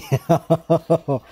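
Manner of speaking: low, rapid rolling laugh; rolling chuckle. Real, not acted. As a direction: let the word dissolve into chuckles. Laughing after a drawn-out damn.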